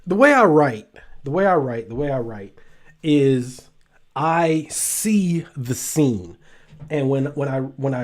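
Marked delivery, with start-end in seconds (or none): none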